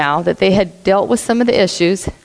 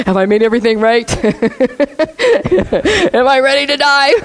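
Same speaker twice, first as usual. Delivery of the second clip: laughing mock worry; 3 semitones higher, faster than usual. Imagining herself before execution.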